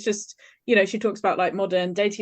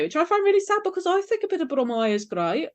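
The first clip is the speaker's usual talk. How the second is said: higher-pitched, put-on Brummie lilt; broad Birmingham vowels; Brummie accent; 7 semitones higher than usual. Shown as a demonstration. Birmingham resident defending the hated accent, playful Brummie accent.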